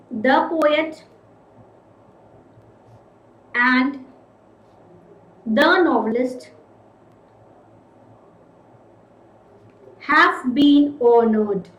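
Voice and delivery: words spaced out with long pauses, "have been honored" very slow; slow dictation. Shown as a demonstration. Teacher writing the second example on the board. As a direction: dictate it word by word. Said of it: "let us take another case".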